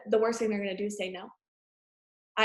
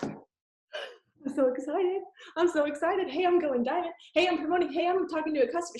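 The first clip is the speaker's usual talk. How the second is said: high, bouncy mock excitement; imitating excited; 6 semitones higher than usual. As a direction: play her giddy, bursting with news. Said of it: "She was so excited".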